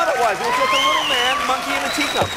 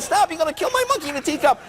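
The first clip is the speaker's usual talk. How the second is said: higher, urgent retold protest; mock frantic plea; 6 semitones higher than usual. Son begging mother to stop hitting his monkey, mock frantic. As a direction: plead in mock alarm.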